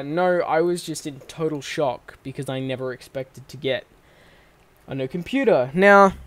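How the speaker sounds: usual speaking voice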